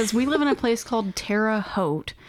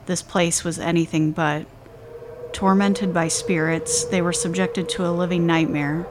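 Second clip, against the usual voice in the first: dramatic narration; 2 semitones lower than usual. Acted. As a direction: read it like a spooky documentary intro. Podcast cold open narration.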